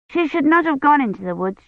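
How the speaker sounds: lightly voiced little girl passing judgment; disapproving little girl. A narrator's reading. Agnes judges the maiden in the tale, disapproving child.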